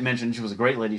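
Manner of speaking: ordinary delivery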